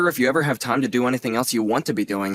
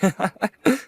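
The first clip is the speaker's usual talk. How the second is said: a bright laugh, pitched up high; high teasing laugh; 8 semitones higher than usual. Acted. Nephew laughing at his aunt's wild past, a high teasing laugh. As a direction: laugh at the memory of her passed out. Nephew recalls aunt passed out.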